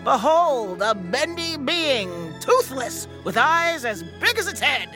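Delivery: slow, grand showman announcement; carnival barker. Pretend. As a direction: build suspense like a sideshow host. Barker presenting a curious creature.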